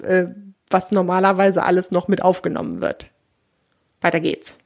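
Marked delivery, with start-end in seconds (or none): none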